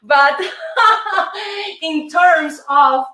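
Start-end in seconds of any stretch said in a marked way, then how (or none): 0.4-1.8 high burst of laughter; bright laugh. Real, not acted. Teacher laughing about handsome men at the gym, high laugh. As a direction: laugh at your own joke.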